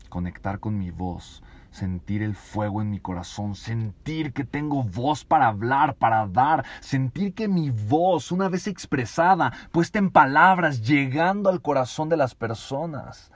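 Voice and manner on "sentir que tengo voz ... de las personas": building passion. Acted.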